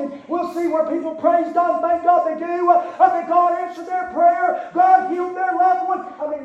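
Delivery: loud preaching with pleading quotes; full-force preaching. Acted. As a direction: push it hard like a revival preacher. Preacher rising again.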